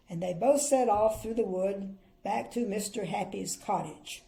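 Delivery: put-on clear, lively storybook reading for children; story narration. A narrator's reading. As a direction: read it like a bedtime picture book.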